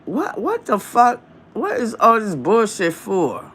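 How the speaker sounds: high, disbelieving what-the-fuck; imitating stunned disbelief; incredulous quote. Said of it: "You'll be like".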